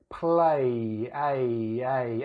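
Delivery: "play" with the final A held and echoed; vowel ending. Shown as a demonstration. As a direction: draw out the A at the end of "play". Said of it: "actually ends in a vowel sound".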